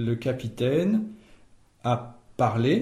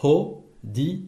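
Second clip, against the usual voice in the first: "Ho, di, aou" as three separated syllables. Shown as a demonstration. Teacher splitting the word into three syllables, one by one. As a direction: break it up: "Ho", "di", "aou". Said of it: "Et il y a trois syllabes".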